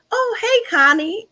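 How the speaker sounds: squealed high and loud, overly chummy; bright mock greeting. Stranger greets Constance by the wrong nickname, high and loud. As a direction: chirp it like a stranger acting like an old friend.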